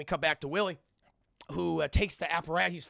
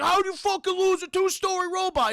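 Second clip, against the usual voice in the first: high, loud enraged shout; angry yelling; 14 semitones higher, 9 dB louder than usual. Retold or imitated. Willie's dad yelling at everybody over the lost robot, furious. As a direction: imitate a father beside himself, yelling. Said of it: "just yelling at everybody".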